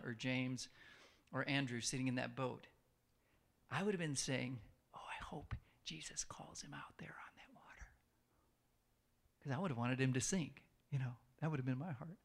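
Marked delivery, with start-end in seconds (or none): 4.9-7.9 whispered jealous aside; sneaky whisper. Retold or imitated. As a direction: whisper it so nobody hears. Jealous disciple's secret wish.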